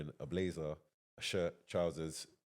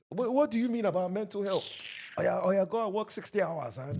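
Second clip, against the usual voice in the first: high, loud dismissive elder impression; hammed-up elder scolding the young; mock older generation; 7 dB louder than usual. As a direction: put on an indignant old-timer scoffing. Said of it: "older generation, mental health don't exist".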